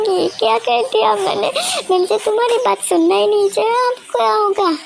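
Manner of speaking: high, tearful panicked words; crying. Acted. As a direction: sob the words out in regret. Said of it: "बहुत ज़्यादा रोती है". (रोते हुए घबराकर बोलना)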